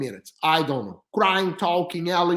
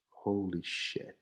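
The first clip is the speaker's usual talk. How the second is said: low, soft and slow, stunned; a quiet, stunned holy shit; stunned whisper; 11 semitones lower, 13 dB quieter, much slower than usual. Retold or imitated. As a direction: breathe it out in shock.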